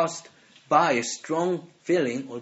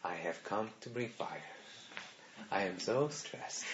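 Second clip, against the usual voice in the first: much softer, lower, detached flat calm; 7 semitones lower, 15 dB quieter than usual. Shown as a demonstration. The wrong way to read Jesus, soft composed calm. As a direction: say it calmly as if nothing's wrong. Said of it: "He's not just saying calmly".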